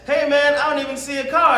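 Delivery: high, doubtful farmer voice; skeptical farmer. Pretend.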